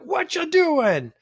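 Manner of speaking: a hammed-up bad Boston accent. Boston stranger getting in your face, in a bad mock Boston accent. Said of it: "That's a very bad accent".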